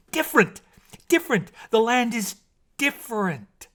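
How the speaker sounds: very high, loud cries of "Different!"; excited discovery. A narrator's reading. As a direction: burst out with the found word. Mermaid excitedly finds the word.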